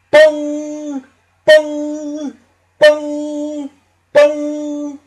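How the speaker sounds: very high, ringing bong each time; loud bell bong. A narrator's reading. Big Ben striking the hour, loud ringing bongs. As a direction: ring out like a huge clock bell. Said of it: "there was a loud".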